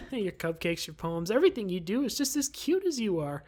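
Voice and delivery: ordinary delivery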